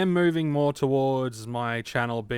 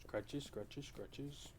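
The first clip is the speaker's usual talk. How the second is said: soft cooing to dog; 20 dB quieter, much slower than usual. Real, not acted. Petting the dog softly.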